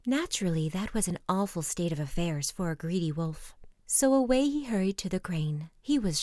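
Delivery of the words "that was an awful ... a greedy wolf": plain reading voice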